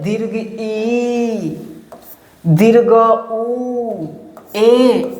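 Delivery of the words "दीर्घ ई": ई drawn out long and slow, a little softer; teacher holding ई to show a long vowel. Shown as a demonstration. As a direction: stretch out the ई in दीर्घ ई. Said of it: "बड़ा वाला ई को क्या बोलते हैं".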